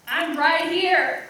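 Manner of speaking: loud, drawn-out insistence; loud protest. Acted. Woman answering lover who wants to see her, loud protest. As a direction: call it out slowly and insistently. Exasperated quote in an intimate scene.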